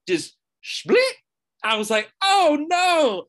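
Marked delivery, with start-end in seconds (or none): 2.2-3.2 high, loud sudden dismay; alarmed oh no. Retold or imitated. Racket splits as he hits the ball, loud alarmed blurt.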